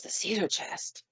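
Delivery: breathless whispered disbelief; shocked whisper. Retold or imitated. Son realizing about the cedar chest, whispered in shock. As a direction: whisper it, stunned. Son stunned hearing the story.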